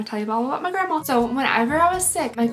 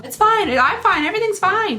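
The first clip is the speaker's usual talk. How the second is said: forced cheer; 8 semitones higher, 4 dB louder than usual. Retold or imitated. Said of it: "I say that saying all the time".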